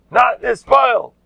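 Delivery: mock proud. Repeating mockery.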